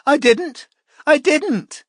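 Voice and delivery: high, tearful repeated denial; sobbing. A narrator's reading. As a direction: plead through sobs.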